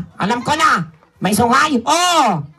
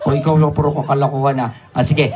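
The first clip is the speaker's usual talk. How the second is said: usual speaking voice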